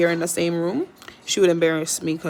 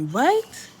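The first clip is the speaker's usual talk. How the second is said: much higher, cooing to her pet bird; high baby talk; 10 semitones higher, slower than usual. Acted. Owner coaxing her parrot to talk, high cooing. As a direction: coo it up high like to a tiny bird.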